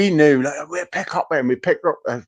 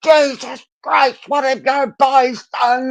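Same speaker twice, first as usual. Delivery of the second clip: shocked mum; 10 semitones higher, slower than usual. Retold or imitated.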